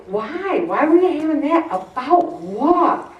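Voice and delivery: playing people who just don't get it; incredulous questioning. Imitating people who ask a panic sufferer why.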